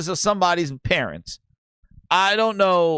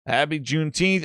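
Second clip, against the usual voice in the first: normal talking voice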